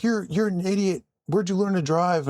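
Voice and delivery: high-pitched mock irate driver; retold mild yell. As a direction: mimic an angry motorist telling you off. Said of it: "scream at me".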